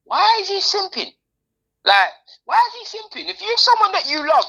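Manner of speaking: high-pitched indignant challenge; incredulous, high-voiced comeback; indignant retort. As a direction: throw the critics' word back at them, high. Acted retort to critics.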